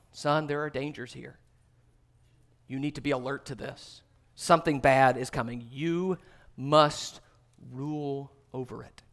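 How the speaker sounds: low, soft and grave, slowing at the end; hushed fatherly warning. Acted. A father cautioning his son about danger, low and hushed. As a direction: warn him quietly, like a father at the door.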